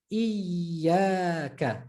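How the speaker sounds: iyaka with a long iyy before the a; stressed, held ya. Shown as a demonstration. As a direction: hold the y in iyaka, slowly. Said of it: "emphasize and stress".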